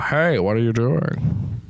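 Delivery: slow, grand Ian McKellen voice; a slow regal British god voice; Ian McKellen impression. Shown as a demonstration. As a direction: intone it like a stately old wizard. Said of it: "But Sir Ian McKellen".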